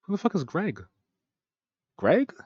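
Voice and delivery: high, incredulous questioning; baffled. Real, not acted. Commentator stumped by the name Greg, incredulous.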